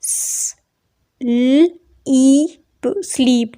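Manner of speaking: स- ल- ई- प- split into separate sounds, then स्लीप said whole; teacher sounds out स्लीप for the class; sounding out. Shown as a demonstration. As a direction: break the word स्लीप into its sounds one by one. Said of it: "इसकी आगे pronunciation देखें".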